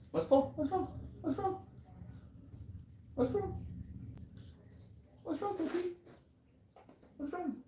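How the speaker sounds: very high, soft coo to a pet. Real, not acted. Checking on the pet, high soft baby-talk coo. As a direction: coo gently at a worried animal.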